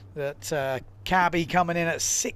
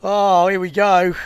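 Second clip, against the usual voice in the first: excited; 3 semitones higher, 11 dB louder than usual. Real, not acted. Excited commentary call.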